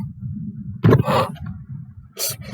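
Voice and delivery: breathy real laugh; helpless voiceless giggling; laughter. Real, not acted. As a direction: lose it laughing, mostly breath. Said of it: "I cannot stop laughing".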